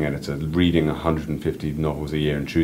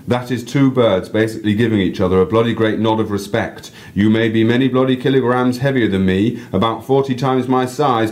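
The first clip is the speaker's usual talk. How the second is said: louder, higher North English showman address; North English accent; 6 semitones higher, 6 dB louder than usual. Acted. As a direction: announce it to the crowd like a northern guide. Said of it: "random North English accent".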